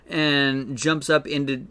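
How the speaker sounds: usual speaking voice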